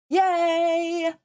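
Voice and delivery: a high, squealing yay; excited cheer. Real, not acted. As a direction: cheer it out, thrilled. Cheering the podcast award news.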